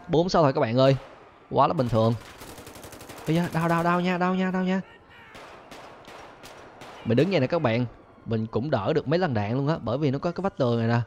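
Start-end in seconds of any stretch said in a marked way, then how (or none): 3.3-4.8 higher playful "đau nha" cries; mock-pained whining. Real, not acted. Under fire, playful high pained whining. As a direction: whine at every hit.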